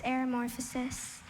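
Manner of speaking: normal talking voice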